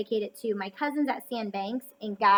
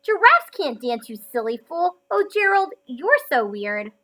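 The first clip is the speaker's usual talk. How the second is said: light mocking taunt; a touch of a jeering crowd; sneering; 4 semitones higher, 8 dB louder than usual. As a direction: tease him like the other animals. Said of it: "the animals all sneered".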